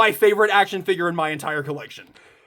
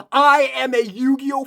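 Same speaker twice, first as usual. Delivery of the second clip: ordinary delivery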